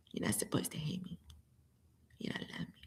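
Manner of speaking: fast voiceless whisper; a secret whispered to the entity. Real, not acted. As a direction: breathe it out secretly, barely audible.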